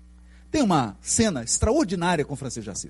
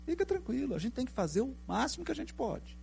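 gentle reassurance; 6 semitones higher, 9 dB quieter than usual. Retold or imitated. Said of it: "Ele falou".

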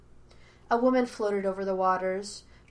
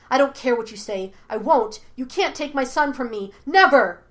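loud furious outburst building to a higher yell; cold anger; 4 semitones higher, 9 dB louder than usual. A narrator's reading. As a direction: rage at whoever would take her son. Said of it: "her voice colder and angrier than he had ever heard it".

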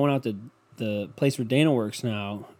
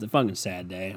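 ordinary delivery

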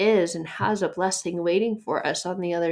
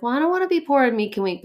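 quick, higher, louder whiny protest; mock objection; 7 semitones higher, 4 dB louder, faster than usual. Preacher voicing a listener's balking, quick mock whine. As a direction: toss off the listener's complaint. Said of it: "You're like".

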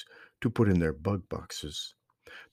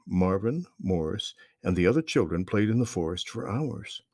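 plain reading voice

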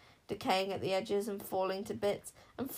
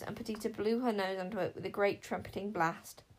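usual speaking voice

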